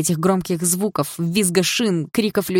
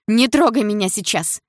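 higher, raised voice; agitated protest; 5 semitones higher, 4 dB louder than usual. A narrator's reading. Upset girl warding off Дима, agitated. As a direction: cry out to be left alone.

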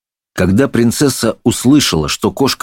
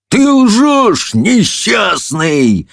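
indignant high-pitched roar; roaring judge; 14 semitones higher, much slower than usual. A narrator's reading. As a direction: roar an accusation, stretching the last word. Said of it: "взревел судья".

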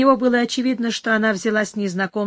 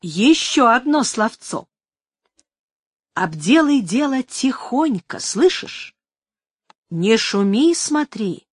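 put-on old woman voice; slower than usual. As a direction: wheedle like a greedy old woman.

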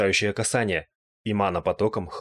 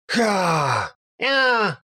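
two cries, the second far higher; startled cry; 11 semitones higher, 3 dB louder than usual. A narrator's reading. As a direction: cry out high in shock. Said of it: "Одновременно закричали они". (испуганный высокий вскрик)

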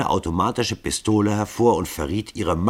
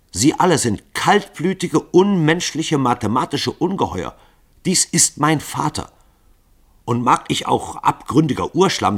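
higher and louder, indignant; outraged defiance; 9 semitones higher, 6 dB louder than usual. Acted. Daughter defending her father, higher and louder. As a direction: hurl it at them.

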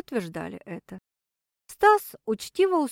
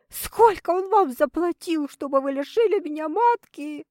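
high, louder hysterical accusation; 7 semitones higher, 4 dB louder than usual. A narrator's reading. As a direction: accuse them on edge of hysteria. Patient accusing doctor, hysterical.

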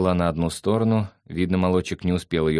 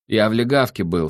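mildly boastful, louder than usual; proud; 5 semitones higher, 5 dB louder than usual. A narrator's reading. Sandro tells his friend where he was, mildly proud. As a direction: announce it with a touch of pride. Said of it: "сказал Сандро с гордостью".

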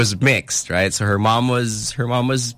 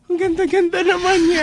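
gushing auntie. Retold or imitated. Mimicking the elder lady admiring the niece, gushing high. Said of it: "that's after she said".

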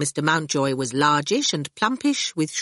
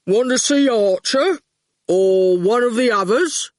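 a big, simple-minded henchman voice, a touch louder; loom over the boy and state it plainly; 4 semitones higher, 4 dB louder than usual. A narrator's reading. Goon grinning at the boy outside school, lightly hulking.

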